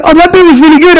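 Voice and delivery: high-pitched imitation of wife; imitating wife. Acting out wife's praise.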